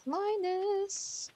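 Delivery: high, drawn-out name call; long singsong hail. Pretend. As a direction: call for someone in a playful high voice. Calling out for a game character.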